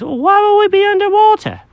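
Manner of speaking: high, fussy cat voice; Jenkins the cat. Acted.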